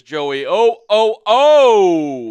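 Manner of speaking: a viewer's hyped cheer, read out loud; excited cheer. Retold or imitated. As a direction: cheer the name, building to a shout. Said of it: "Tony says".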